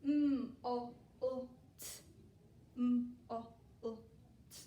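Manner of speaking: mm, o, u, t, each sound said separately; teacher sounding out letters. Shown as a demonstration. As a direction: segment molt into its sounds. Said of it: "we have to find our sounds".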